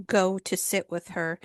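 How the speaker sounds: ordinary talk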